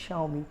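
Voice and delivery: Xiaomi as "SHAO-mee"; low falling Xiaomi, said "shao-mi"; correct pronunciation. Shown as a demonstration. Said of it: "correct pronunciation क्या है".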